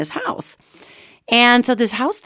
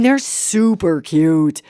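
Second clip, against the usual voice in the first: ordinary talk, nothing marked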